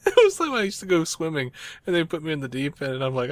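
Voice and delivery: ordinary talk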